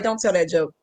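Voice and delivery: low, soft imitated admonishing; mom's warning. Mom warns comic off a joke, low hushed voice. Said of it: "she'd be like".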